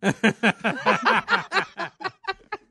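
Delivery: high loud burst of laughter. Real, not acted. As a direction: howl with laughter. Laughing at wife joke.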